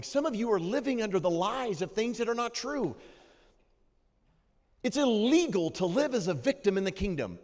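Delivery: rising urgent exhortation; fervent preaching. Acted. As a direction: drive the point home to the crowd.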